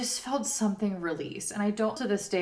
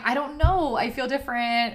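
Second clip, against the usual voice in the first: mock panicked plea; 6 semitones higher, 6 dB louder than usual. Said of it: "I just kept saying".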